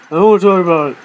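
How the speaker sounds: mild mock sulk of a kid; pouting. Embarrassed boy asked about his tattoo, mildly pouting. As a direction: grumble it with a slight pout. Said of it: "sitting there in the chair just pouting".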